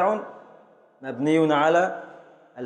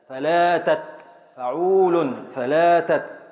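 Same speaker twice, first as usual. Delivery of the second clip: فلاتت drawn out slowly, each syllable apart; slow scansion; much slower than usual. Shown as a demonstration. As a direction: stretch فلاتت out slowly for the class. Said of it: "هنقف على التاء الساكنة". (تقطيع بطيء ممدود)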